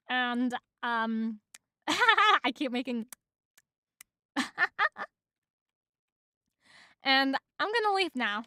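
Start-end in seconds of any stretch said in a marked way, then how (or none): none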